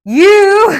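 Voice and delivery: loud, high, thrilled call; excited exclamation. Real, not acted. Reader calling out to a tarot card. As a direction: burst out, delighted.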